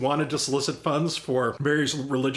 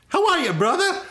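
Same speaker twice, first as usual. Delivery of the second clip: high, loud flamboyant [name] voice; [name] impression; 4 dB louder than usual. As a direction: call out high and showy like a rock star.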